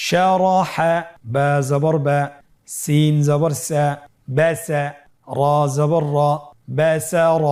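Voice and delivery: loud, measured letter-by-letter recitation; spelling-out drill. Shown as a demonstration. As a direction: chant each letter and vowel, then join them. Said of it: "এভাবে বানান করে পড়ব".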